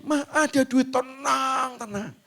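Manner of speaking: high, choked reassurance; mock tearful mother. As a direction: soothe him through tears. (ibu menenangkan sambil terisak)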